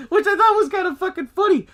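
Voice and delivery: high laughter through the words; real laughing. Real, not acted. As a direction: laugh while telling it. Speaker laughs at scene.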